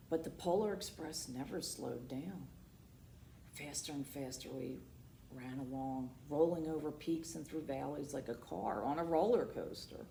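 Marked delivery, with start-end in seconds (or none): none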